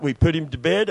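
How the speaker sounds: ordinary talk, nothing marked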